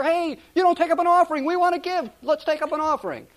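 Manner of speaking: a light hammed-up cry of people begging to give; mock church outcry. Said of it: "a church screaming to the pastor".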